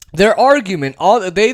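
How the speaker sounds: ordinary talk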